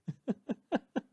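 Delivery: a short high-pitched cackle; high giggle. Acted. The host giggles at his own quip with a short high cackle. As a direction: giggle at your own joke.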